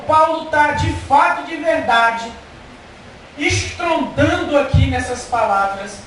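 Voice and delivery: rising sermon fervour; fervent preaching. Acted. Preaching at raised force.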